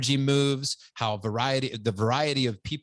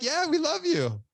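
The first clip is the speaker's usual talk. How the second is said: a friend's reassurance in a much higher voice; lightly voiced friend. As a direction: gently confirm the love, pitched up high. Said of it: "She's like".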